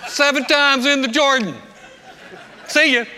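high, loud messenger's shout; calling out. Acted. Messenger shouting Elisha's orders out the door, calling out. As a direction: holler it out the door, pitched high. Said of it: "sticks his head out the door and said".

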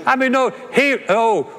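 ordinary talk

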